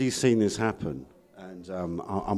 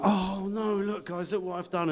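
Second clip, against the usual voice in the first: higher, softer fake oops; mock dismay; 8 semitones higher, 7 dB quieter than usual. Showing friends the spill, mock innocent dismay. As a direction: pretend it was an accident. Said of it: "I went".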